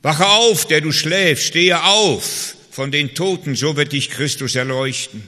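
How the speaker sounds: loud, high, slow pulpit call; loud preaching, the last phrase slow; proclaimed verse. Acted. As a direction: thunder the verse out as a wake-up call. Preacher proclaims Bible verse aloud.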